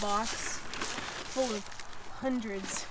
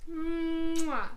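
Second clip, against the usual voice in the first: kiss sound; 4 semitones higher than usual. Pretend. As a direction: blow a big smooch. Blowing kiss to cat.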